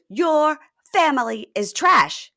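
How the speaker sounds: high, loud, slow mock outrage; acted insult. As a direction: hurl it slowly as the angry girlfriend. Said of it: "she goes".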